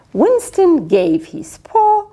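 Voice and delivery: plain reading voice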